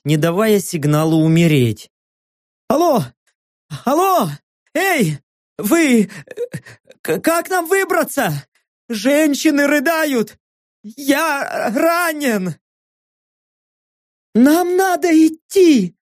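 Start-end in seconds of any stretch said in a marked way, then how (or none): 2.7-12.6 panicked pleading. Acted. Hero keeps panicked act. 14.3-16.0 acted alarm. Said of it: "продолжила свой спектакль наёмница".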